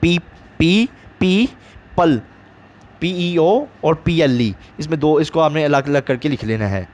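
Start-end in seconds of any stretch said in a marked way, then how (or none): 0.6-2.2 "पी, पी, पल" broken into separate beats; syllable split. Shown as a demonstration. Teacher showing students the syllables, sounded out separately. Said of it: "इसमें मेरे ख्याल से दो sound हैं".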